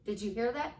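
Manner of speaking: ordinary delivery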